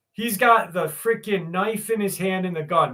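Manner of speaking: usual speaking voice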